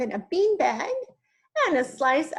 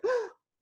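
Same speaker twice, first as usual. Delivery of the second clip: shocked gasp; 12 semitones higher, 3 dB quieter than usual. Acted. Townsfolk see the giant.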